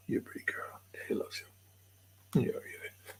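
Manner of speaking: soft whisper to a shy dog. Real, not acted. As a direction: whisper sweet nothings to the dog.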